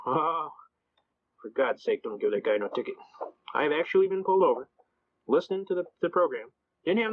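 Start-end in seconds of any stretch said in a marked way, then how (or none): 0.1-3.0 low, exasperated imitation of a cop; weary groan. Police spotting the familiar troublemaker, mock weary groan. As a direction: sigh it out low, mimicking a fed-up officer.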